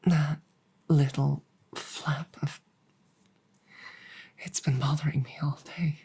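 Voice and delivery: soft, fast, hushed confession; quick relieved whisper. Acted. Dragon admitting to his mate the flap bothered him, fast whisper. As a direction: rush it out under your breath. Confiding relief after peeling.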